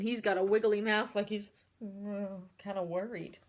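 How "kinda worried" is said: ordinary delivery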